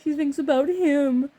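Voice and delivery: drawn-out sulky complaint, mocking; mocking whiny boyfriend. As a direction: mimic a pouting jealous man. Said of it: "he gets all, like, butthurt about it".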